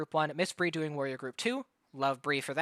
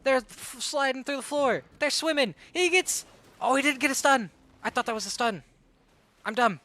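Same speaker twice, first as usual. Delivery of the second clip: rapid, rising esports commentary; live play-by-play; 3 semitones higher, 3 dB louder than usual. Real, not acted. Caster calling the match for stream viewers, hyped play-by-play. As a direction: call every move as it happens.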